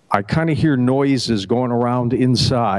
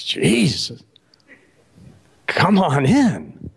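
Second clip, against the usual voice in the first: a lightly voiced gatekeeper, words drawn out slow; a hint of a weary saint waving him through, slowly; Saint Peter welcoming; 2 semitones higher, much slower than usual. Saint Peter greets the third arrival, lightly voiced and slow. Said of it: "Peter says".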